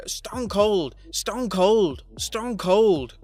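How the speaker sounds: high, loud mock catchphrase yell; imitated yelling. Boss showing how to impersonate a wrestling commentator, imitated yelling.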